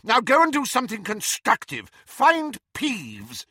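high, forceful order; exasperated shout. A narrator's reading. Professor sends the caretaker on an errand, shouting. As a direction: order him off impatiently. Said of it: "shouted McGonagall".

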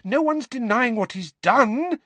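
high, loud and rising; rising anger, barely held; angry mother rising. A narrator's reading. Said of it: "her voice rising".

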